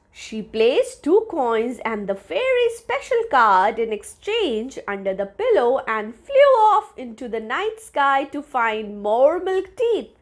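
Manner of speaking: ordinary delivery, nothing marked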